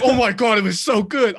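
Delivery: imitating a thrilled moviegoer; gushing fan. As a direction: rave like a fan who just found another. Retold stranger gushing about film.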